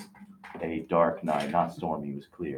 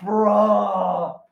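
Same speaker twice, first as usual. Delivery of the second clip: high, louder fake cry on bruh; mock whining bruh; 13 semitones higher, 6 dB louder than usual. Friend fake-cries over the spooky story, high and whining. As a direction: whine it like a fake sob.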